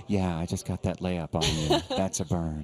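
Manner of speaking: low, slow put-on murmur of a player's taunt; mock soft-spoken trash talk. Host imagines soft-spoken player taunting on court, mock soft-spoken. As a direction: tease her with gentle, low, unhurried trash talk. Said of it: "got a really soft speaking voice".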